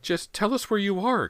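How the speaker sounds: high, loud frightened shriek; mother shrieking for her missing son. A narrator's reading. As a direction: shriek it in terror. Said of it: "Callie's mother shrieked".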